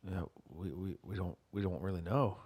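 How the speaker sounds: quiet, low, slowed solemn fake; hushed grave tone. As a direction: murmur it like bad news. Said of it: "And I'm like".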